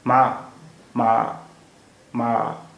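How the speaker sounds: "माँ" with the pitch moving as the tone mark shows; teacher glides the pitch through "माँ"; tone demo ma. Shown as a demonstration. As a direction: model "माँ" with a gliding pitch for the tone. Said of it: "आवाज़ ऊपर से शुरू होकर नीचे आती है".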